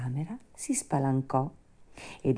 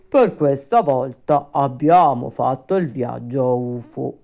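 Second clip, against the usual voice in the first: louder, put-on grim creature voice; resigned rabbit undertaker; 7 dB louder than usual. Rabbits lament their wasted trip, resigned. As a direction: grumble like a disappointed undertaker.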